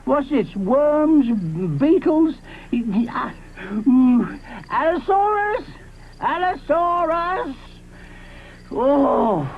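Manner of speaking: high, rising alarm; alarmed Grandpa Diplodocus. Acted. Grandpa Diplodocus realizes an Allosaurus may be near, high alarmed voice. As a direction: puzzle then take fright.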